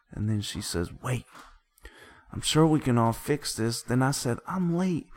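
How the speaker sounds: dramatic recitation. Acted. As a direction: act out each side of the quarrel while narrating.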